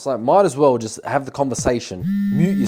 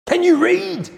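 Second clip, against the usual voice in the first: incredulous; 4 dB louder, slower than usual. Retold or imitated. Exasperated retort to girl.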